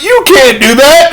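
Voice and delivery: high-pitched acted telling-off; mock scolding. Uncle reprimands nephew after newspaper swat, mock scolding.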